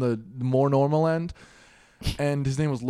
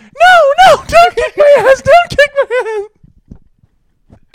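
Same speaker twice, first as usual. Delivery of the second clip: panicked, very high squealing plea; Brendan; 16 dB louder than usual. Acted. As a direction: beg loudly in a squeaky mock voice. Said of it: "his voice is high like this".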